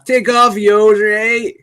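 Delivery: loud, high Canadian caricature; mock Canadian. Fan quoting the McKenzie brothers, loud mock Canadian. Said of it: "the Canadian accent was".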